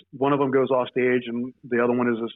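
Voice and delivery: ordinary talk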